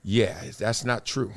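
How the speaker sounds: low, slow, soft dismissal; unriled. Retold or imitated. Pundit answering people going at him, calm and unbothered. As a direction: imitate a pundit calmly brushing it off. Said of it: "I don't get riled up".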